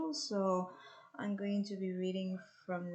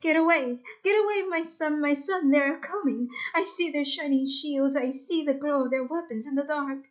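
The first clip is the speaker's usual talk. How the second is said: raised, higher urgent warning; crying out alarmed; 6 semitones higher, 8 dB louder than usual. Acted. Old father warning his son of approaching soldiers, urgent shout. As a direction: call out to your son that the enemy is coming. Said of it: "cries out to me".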